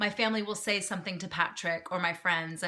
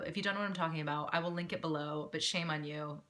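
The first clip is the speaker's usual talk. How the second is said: ordinary talk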